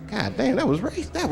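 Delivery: stunned disbelief. Retold or imitated. Stunned reaction to racism.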